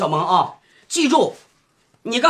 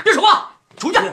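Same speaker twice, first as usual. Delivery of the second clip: angry order; 9 semitones higher than usual. Acted. Father orders son out, quarrel.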